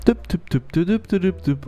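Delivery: little rhythmic düpp sounds; playful step-by-step sound effect; footstep noises. Pretend. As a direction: mime tiptoeing in with your mouth. Imitates sneaking footsteps.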